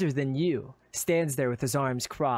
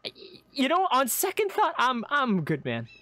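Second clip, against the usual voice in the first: high, stammering back-out; nervous refusal; 10 semitones higher than usual. Acted. Adventurer backing out of the deal, nervous. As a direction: back away politely with a stammer.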